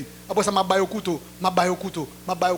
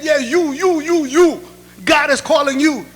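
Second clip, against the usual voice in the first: louder, higher, pointing call; single him out across the church; insistent calling out; 4 semitones higher, 7 dB louder than usual. Retold or imitated. Pastor picking out the young man at the back, loud and insistent.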